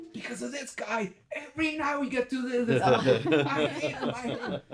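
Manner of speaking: very high, loud comic griping; mock outraged complaint. Imitating friend's mock complaint about him.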